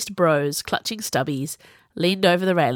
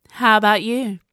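raised, louder retort; snappish; 4 semitones higher, 5 dB louder than usual. A narrator's reading. Viola challenging Sage in return, snappish. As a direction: turn the question back sharply. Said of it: "she snapped at him".

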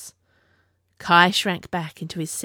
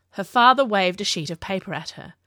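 ordinary delivery

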